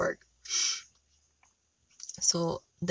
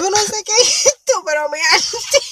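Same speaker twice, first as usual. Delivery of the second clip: put-on whiny kid excuse; 11 semitones higher, 5 dB louder than usual. Pleading child voice.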